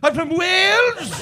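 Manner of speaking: hammed-up Welsh pride; mock Welsh accent. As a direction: drawl it out in a put-on Welsh voice. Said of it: "And then all of a sudden".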